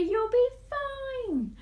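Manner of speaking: high slow sly snake voice; sneaky snake. Acted. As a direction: tempt her slyly. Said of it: "the sneaky snake ... told Eve a lie".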